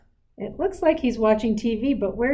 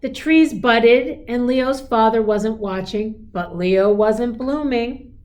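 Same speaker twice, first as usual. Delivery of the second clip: storybook narration; 3 semitones higher, 4 dB louder, slower than usual. A narrator's reading.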